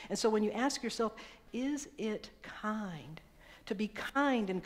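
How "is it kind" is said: slow reflective question. Acted. Asking yourself slowly.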